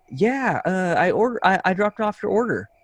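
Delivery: high, mock-ditzy lady voice; imitating the delivery lady. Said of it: "she was like".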